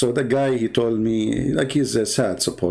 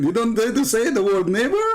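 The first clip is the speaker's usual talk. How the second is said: mocking wife and neighbor; 8 semitones higher than usual. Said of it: "they start laughing".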